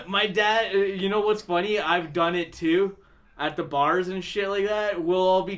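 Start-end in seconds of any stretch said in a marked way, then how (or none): none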